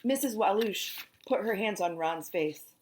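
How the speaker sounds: plain reading voice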